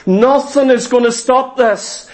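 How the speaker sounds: ordinary delivery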